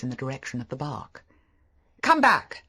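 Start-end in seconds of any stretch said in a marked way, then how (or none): 2.0-2.6 sharp, loud call to stop; sharp command. A narrator's reading. Maxim ordering his wife off the rocks, sharp and loud. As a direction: snap her back from the rocks. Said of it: "said Maxim sharply".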